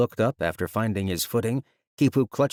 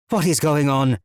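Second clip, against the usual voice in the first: loud, higher demand; 6 semitones higher, 6 dB louder than usual. Acted. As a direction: demand an answer. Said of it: "Watani demanded".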